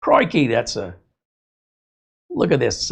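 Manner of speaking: lightly imitated Australian accent; hint of an Aussie croc hunter accent; Steve Irwin. Likening the drain video man to a wildlife host, mock Australian accent. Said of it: "you remember Steve Irwin?".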